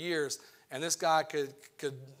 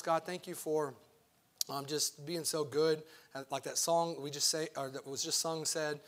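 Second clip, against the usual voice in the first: softer, lower prayer voice, head bowed; quiet prayer; 4 semitones lower, 4 dB quieter than usual. Acted. Pastor praying to God before the sermon, softly and humbly.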